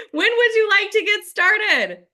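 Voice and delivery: slow, punchy, laughing through the question. Shown as a demonstration. Coach to her team, showing the closing question through laughter. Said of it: "Are you guys even asking that question?".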